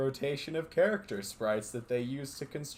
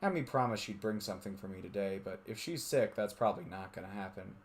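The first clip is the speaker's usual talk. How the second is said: steady narrator reading a visual novel; story narration; 4 semitones lower, 6 dB quieter than usual. A narrator's reading. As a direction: read it like a storyteller.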